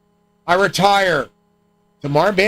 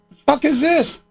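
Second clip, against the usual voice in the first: baffled outburst; 10 semitones higher, slower than usual. Retold or imitated. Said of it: "I'm like".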